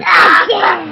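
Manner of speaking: loud gleeful shout of the word. Real, not acted. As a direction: yell it out with gusto.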